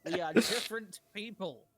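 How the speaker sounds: loud, high, hammed-up indignation; mock outrage. Halfling player mistaken for the other halfling, mock outrage. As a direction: play deeply affronted for laughs.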